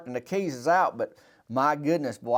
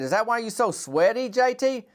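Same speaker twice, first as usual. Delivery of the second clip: teasing; 9 semitones higher than usual. Retold or imitated. Myron teasing question.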